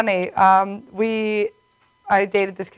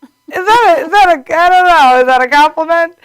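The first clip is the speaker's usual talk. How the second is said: higher, louder confused question; mock baffled question; 6 semitones higher, 6 dB louder than usual. Retelling reply to ex's odd remark, raised and baffled. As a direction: ask it half offended, half unsure. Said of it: "And I'm like".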